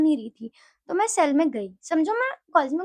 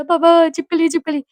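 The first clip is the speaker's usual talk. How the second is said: raised, louder cry to dad; retold panic; 3 semitones higher, 7 dB louder than usual. Daughter phoning papa about a lizard, mock panic. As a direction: blurt it out in mock alarm. Frightened phone call to papa.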